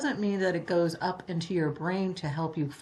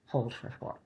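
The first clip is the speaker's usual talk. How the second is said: whispered count; 6 semitones lower, 6 dB quieter than usual. Shown as a demonstration. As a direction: whisper the last count, lower and softer. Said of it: "box breathing count demo".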